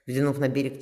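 ordinary delivery

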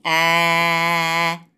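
long level ah for the doctor; saying ah at the doctor; open-mouth ah. A narrator's reading. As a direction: hold a steady ah, mouth wide. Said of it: "decí".

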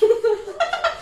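a sudden high-pitched laugh. Real, not acted. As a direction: burst into a short high laugh. Risa tras everything sucks. (risa aguda y breve)

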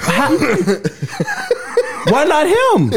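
high, laughing "why not him"; laughing protest. Real, not acted.

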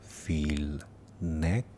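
slow meditation voice with long pauses; guided meditation. Acted. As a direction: guide them gently through the body, unhurried.

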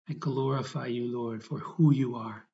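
ordinary delivery

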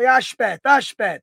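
aspet said as ah-SHPET, Italian-American style with the final vowel clipped; Italian word aspet. Shown as a demonstration. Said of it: "in Italian, we like to say".

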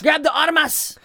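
high shouted Spanish cry; mock alarm. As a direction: cry out like a panicked bandit. Mock alarm, police coming.

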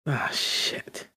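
whispered curse to himself; hushed, dismayed muttered swear; under his breath. Acted. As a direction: let the swear slip out in a whisper. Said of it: "under his breath".

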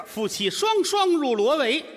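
high, slow riddle chant; riddle recitation. Acted. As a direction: draw each word out for the crowd.

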